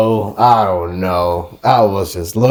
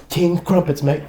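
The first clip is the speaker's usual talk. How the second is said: high, put-on British accent; hammed-up fake Brit; mock British accent; 9 semitones higher than usual. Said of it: "it was about some British gangsters".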